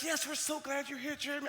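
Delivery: high, soft gushing welcome; light, high mock-sweet greeting; bubbly. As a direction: imitate an over-glad teacher in a high, hushed voice. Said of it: "everybody was so bubbly and so excited".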